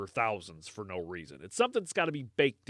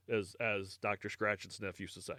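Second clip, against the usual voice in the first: ordinary delivery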